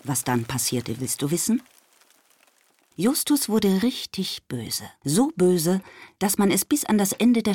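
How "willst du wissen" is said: ordinary delivery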